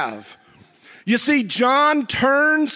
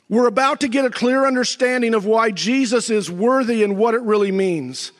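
normal talking voice

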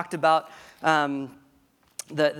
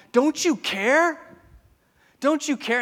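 high, loud, slow desperate plea; panicked disciples; 10 semitones higher, 7 dB louder than usual. Retold or imitated. Disciples wake Jesus in the storm, high panicked plea. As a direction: cry out to him in panic. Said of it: "they're like".

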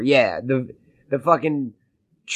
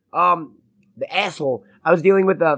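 normal talking voice

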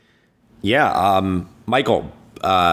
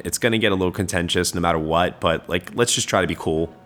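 normal talking voice

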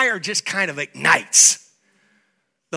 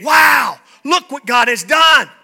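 awed shout; 7 semitones higher, 9 dB louder, slower than usual. Retold or imitated. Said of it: "you will say".

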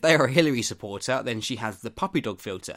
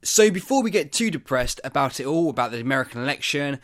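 higher, louder host hype; upbeat segue; 4 semitones higher, 6 dB louder than usual. Real, not acted. Host leading listeners into the podcast game, excited and loud.

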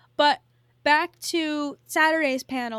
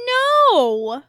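emphatic no; 5 semitones higher, 11 dB louder than usual. Real, not acted. Rhetorical protest ending question.

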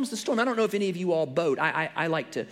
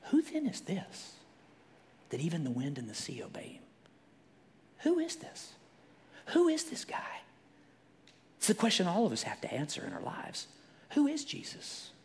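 awed whisper; 2 semitones higher, 5 dB quieter than usual. Real, not acted. Preacher pondering who Jesus is, hushed. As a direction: ask it in hushed awe. Awed questions, breathy and high.

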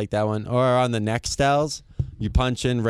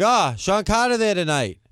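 prank caller; 9 semitones higher than usual. Retold or imitated. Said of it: "Be like".

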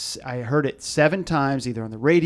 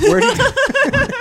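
normal talking voice

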